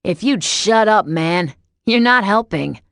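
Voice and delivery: loud, slow yell. A narrator's reading. Peter yelling at Adam to shut up, loud annoyed yell. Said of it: "Peter lifted his head and yelled".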